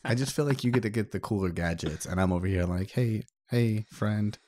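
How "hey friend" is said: ordinary talk, nothing marked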